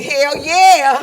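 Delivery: high, loud hell yeah; cocky cheer. Acted. Comic signing car papers, loud cocky cheer. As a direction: whoop it like you're on top of the world.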